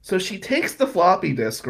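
ordinary delivery

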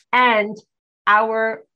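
ordinary talk

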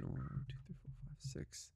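counting under breath; whispered counting. Real, not acted. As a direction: murmur the numbers to yourself.

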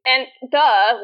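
usual speaking voice

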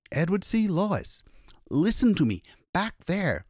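high, urgent insistence; distraught and insistent; agitated urging. A narrator's reading. Agitated man urging police to listen.

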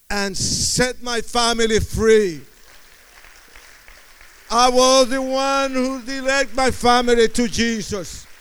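rising from steady to a loud triumphant shout; building preaching shout. Acted. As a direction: build it up to a full preacher's roar. Preaching climax of testimony.

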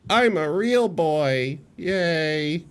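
put-on high, slow, drawn-out boy voice; mock a delighted puppet come to life; little puppet boy. Plays puppet who became real.

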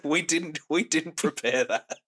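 real laughter breaking through the words; laughing. Real, not acted. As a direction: crack up mid-sentence after a silly noise. Cracking up after silly sound effect.

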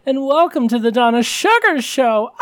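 high, over-the-top drag diva voice; campy drag queen. Acted. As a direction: camp it up as a glamorous show host. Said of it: "this voice".

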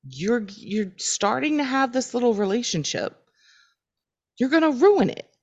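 high, imploring quoted warning; acted-out caution, pitch climbing toward the end; pleading warning. Roommate warning a friend off a confession, pleading warning. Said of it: "Sean is saying".

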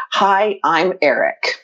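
slow, deliberate text readout; reading a text. Retold or imitated. Traveller reads a stranger's text to her friend, slow and deliberate. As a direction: spell out the message slowly, word by word.

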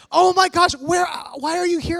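high, loud startled shout; shocked. Retold or imitated. Kid shocked to meet the preacher again, shouting in surprise. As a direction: blurt it out in disbelief.

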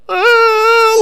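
very high, loud sobbing gasp; mock tearful cry; overwhelmed gasp. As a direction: imitate a mom bursting into tears. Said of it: "She's like".

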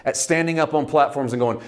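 ordinary talk, nothing marked

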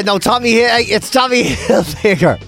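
ordinary talk, nothing marked

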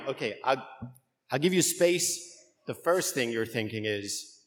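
ordinary delivery

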